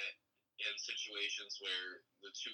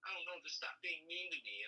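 imitating her, higher and whiny; put-on plaintive girl voice; a hint of a hurt girlfriend; mock whiny girl; 8 semitones higher, faster than usual. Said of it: "she's like".

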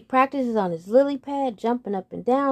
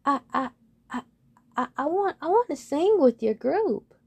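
higher, halting stutter; 6 semitones higher than usual. Acted. Nervous frog asking the birds, stuttering. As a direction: trip over the first word. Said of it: "The frog begins to stutter".

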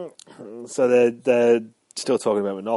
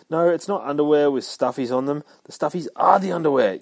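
mildly exasperated, raised and louder; insistent sales pitch; 5 semitones higher, 5 dB louder than usual. Acted. Deadpool pitching underwear by phone, mildly insistent. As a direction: lean on the key words to correct the listener.

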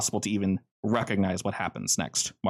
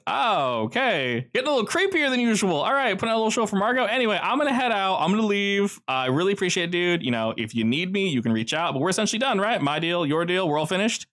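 nervous Joe; 9 semitones higher, faster than usual. Acted. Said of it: "And he goes".

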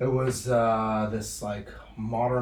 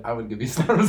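laughing; 7 dB louder than usual. Real, not acted. Teasing friend, laughing.

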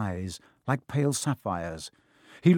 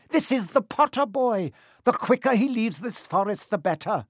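high-pitched centaur voice, insistent; 9 semitones higher than usual. Acted. Centaur defends carrying the boy, high and insistent. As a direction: argue back in a much higher voice. Firenze defends himself to Bane.

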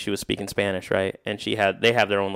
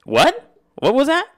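high, louder retold double take; taken aback; 6 dB louder, slower than usual. Man retells hearing his accent praised, startled. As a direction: act out a startled, high-pitched question.

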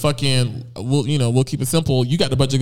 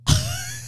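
high laugh; 4 dB quieter than usual. Real, not acted. Laughing after her quote.

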